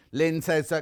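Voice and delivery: usual speaking voice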